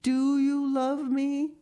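high, loud, drawn-out question; amazed cry. A narrator's reading. Anne asks Diana if she is loved, amazed high cry. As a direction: ask it in breathless wonder, slowly. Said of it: "cried Anne".

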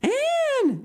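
very high, loud shocked cry; shocked, scolding exclamation; exclaimed in consternation. A narrator's reading. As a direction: call the name out, aghast. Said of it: "exclaimed Marilla in consternation".

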